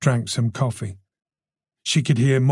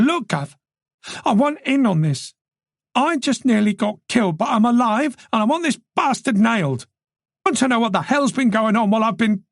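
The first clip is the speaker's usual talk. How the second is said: high-pitched, heated plea; agitated and determined, pitched high; distraught insistence; 11 semitones higher than usual. A narrator's reading. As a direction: push to be let in, voice climbing towards breaking down. Distraught demand to stay on case.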